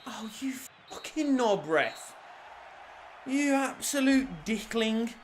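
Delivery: furious whisper at the ref; muttered swearing at the referee; whispered curse. Real, not acted. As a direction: hiss the insult under your breath. Cursing the referee under breath.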